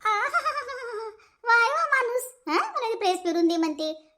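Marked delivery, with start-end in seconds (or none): 0.1-1.1 high, slow sarcastic "aahaha"; mocking drawn-out laugh. पति के हुक्म पर पत्नी का ताना. 1.4-2.5 high, drawn-out mock admiration; sarcastic praise. पति के हुक्म पर पत्नी का ताना.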